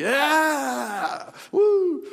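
high, loud drawn-out whoop; mock cheering. Preacher mimicking glee at telling children about Goliath, mock cheering. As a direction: cheer like an over-excited crowd.

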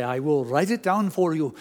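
light Indian accent imitation of his doctor. Doctor explaining to a confused patient, lightly in an Indian accent. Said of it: "he spoke with that Indian accent".

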